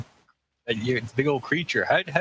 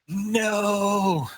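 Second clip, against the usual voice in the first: high dismayed cry; dramatic no; 9 semitones higher than usual. Acted. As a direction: cry out in mock panic. Panic over no pen.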